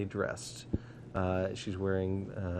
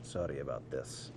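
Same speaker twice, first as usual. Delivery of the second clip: much softer, lower aside; dwarf apologizes under his breath to the party, softly; 9 semitones lower, 10 dB quieter than usual. Acted. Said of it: "under his breath".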